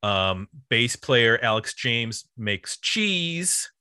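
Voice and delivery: ordinary talk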